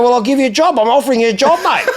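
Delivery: high, loud mock exasperation; retold exasperated offer. Car dealer offering a lowball caller a job, exasperated.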